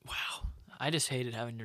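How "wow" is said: a drained, barely voiced wow; whispered wow. Real, not acted. Podcast cohost exhausted by the plot recap, whispering. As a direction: let the word sag out on a breath. Tired after retelling the plot.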